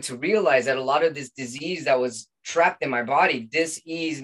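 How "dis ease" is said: "dis-ease" said slowly as two parts, dis then ease; dis-ease split apart. Shown as a demonstration. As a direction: pull "dis-ease" apart slowly, stress both halves. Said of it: "meaning not at ease".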